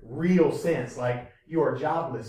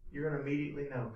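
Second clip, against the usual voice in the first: "You're gonna immediately know" said softer and quick; hushed repeat; 2 semitones lower, 9 dB quieter, much faster than usual. Shown as a demonstration. Preacher repeating a point to the congregation, hushed and quick. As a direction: drop the voice and rush the repeat.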